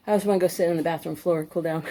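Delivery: ordinary talk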